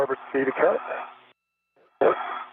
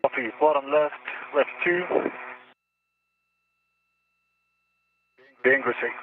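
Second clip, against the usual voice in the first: sci fi communicator; 3 semitones lower than usual. Real, not acted. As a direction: crackly space radio voice. Astronaut radio readback.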